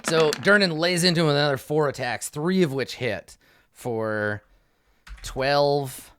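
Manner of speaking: ordinary talk, nothing marked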